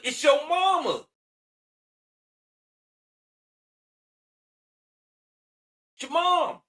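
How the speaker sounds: high, pleading insistence; real exasperated plea. Real, not acted. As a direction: press the point hard. Insisting the parent is sacred.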